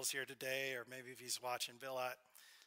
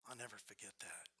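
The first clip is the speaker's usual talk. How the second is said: whispered; 7 semitones lower, 12 dB quieter, faster than usual. Real, not acted. Moved eulogy remembering friend.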